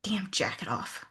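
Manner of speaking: hissed whisper, unvoiced. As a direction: whisper it sharply under breath. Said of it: "she said".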